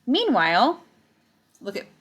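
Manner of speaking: ordinary delivery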